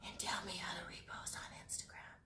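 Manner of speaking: hushed, voiceless whisper; whispered aside. Pretend. Vlogger asking viewers for Instagram help, in a whisper. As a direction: whisper it like a sneaky extra request. Confiding aside after subscribe plea.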